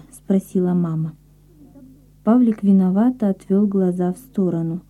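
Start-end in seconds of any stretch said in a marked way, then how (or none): none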